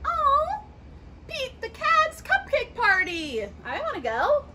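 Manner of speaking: ordinary delivery, nothing marked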